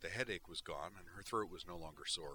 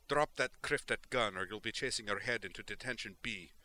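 snarled threat; 3 semitones higher, 5 dB louder than usual. Acted. Tally threatening Melandra at gunpoint, snarled threat. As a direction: growl it with the gun in her face. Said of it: "she snarled".

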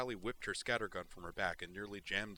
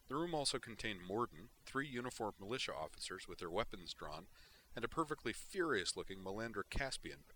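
plain reading voice

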